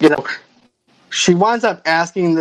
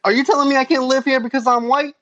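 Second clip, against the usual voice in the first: raised-pitch mock indignation; indignant homeowner; 7 semitones higher than usual. Homeowner protests to FBI agent, mock-indignant higher voice. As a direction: act out an affronted homeowner. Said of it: "He said, uh".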